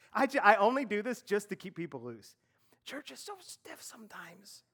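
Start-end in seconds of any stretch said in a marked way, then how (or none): 2.8-4.6 whisper. Real, not acted. Whispered aside to the congregation.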